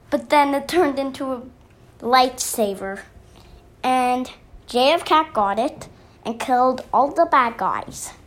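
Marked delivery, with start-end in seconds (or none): none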